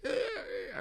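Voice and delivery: very high imitated wail; mock kid's crying. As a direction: whine like a hurt little kid. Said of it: "this kid is like".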